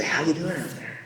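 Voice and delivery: soft throwaway imitation of stage greeting. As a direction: toss off the crabby catchphrase for a laugh. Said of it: "such an old crab when he talks".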